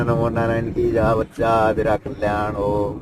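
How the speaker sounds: low, slow, lightly voiced guru; guru's blessing. Guru blessing the newly arrived seeker at his fire, lightly voiced low and slow. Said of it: "गुरुजी धूने पे बैठे हुए".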